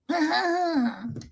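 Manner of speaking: high, drawn-out eerie wail; spooky wordless sound to scare someone; creepy noise. Acted. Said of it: "just making the sounds".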